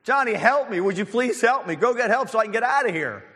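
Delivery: high, urgent calling to a friend; mock scream for help. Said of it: "and he screamed".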